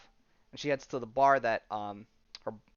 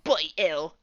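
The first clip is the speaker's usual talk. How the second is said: higher, raised Brit-style exclaim; milder exclamation; 5 semitones higher, 7 dB louder than usual. Retold or imitated. Repeats the exclamation more calmly.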